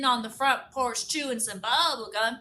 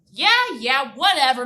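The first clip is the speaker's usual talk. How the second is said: dismissive; 8 dB louder than usual. Acted. Herman dismissive.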